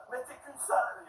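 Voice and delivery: high-pitched retort in French; mock French yelling. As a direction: imitate an irritated French clerk. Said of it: "started to yell there".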